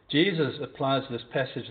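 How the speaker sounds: ordinary delivery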